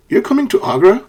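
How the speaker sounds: "You're coming to Agra?" swooping up high on "Agra"; questioning rise. Shown as a demonstration. Teacher showing how native speakers ask, questioning rise. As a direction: turn the statement into a question by lifting "Agra". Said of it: "sometimes native speakers could say".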